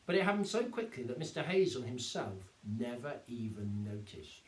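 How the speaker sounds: ordinary delivery, nothing marked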